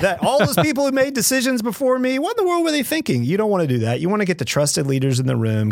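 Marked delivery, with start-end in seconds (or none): 0.0-3.2 much higher, hammed-up incredulous scorn, faster at the end; mock scornful newcomer. Guest mocking a new leader scorning past decisions, hammed-up disbelief. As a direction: play the know-it-all aghast at past decisions.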